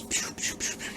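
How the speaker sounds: whispered pew pew blaster noises; mock laser sounds. Narrating the gunfight, whispered pew pew blasts. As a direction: breathe out little gun sounds, voiceless. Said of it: "and he goes".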